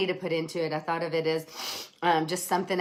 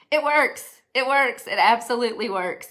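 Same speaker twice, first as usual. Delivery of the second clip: higher, louder, triumphant; excited declaration; 6 semitones higher, 6 dB louder than usual. Real, not acted. Telling viewers after thirteen years, triumphantly excited. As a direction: proclaim it to the whole audience.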